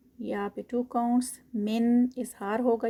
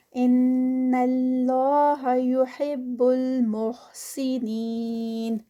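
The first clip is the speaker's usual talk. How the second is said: slow, drawn-out tajweed chant; slow melodic Quranic recitation; 4 dB louder, much slower than usual. Shown as a demonstration. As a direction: recite it with careful elongation. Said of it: "ان پر غنہ".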